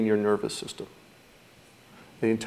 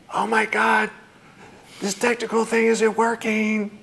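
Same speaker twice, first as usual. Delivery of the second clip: much higher, strained panic about the broken tech; panicky; 12 semitones higher than usual. Shown as a demonstration. Teacher demonstrating the panicked version of a thought, high frantic alarm. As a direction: squeak it out as if everything is collapsing. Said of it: "but we can do it in a panic".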